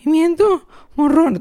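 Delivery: put-on high, slow, anxious child rehearsing the words; a scared boy drilling the phrase; nervous little kid. Little boy practicing, scared of getting it wrong. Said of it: "bien asustado".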